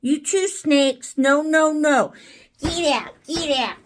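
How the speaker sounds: ordinary talk, nothing marked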